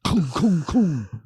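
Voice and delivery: booming mouth-made shotgun shots; imitated gunfire. Friend imagines unloading on a car, imitated gunfire. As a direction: sound out each blast separately.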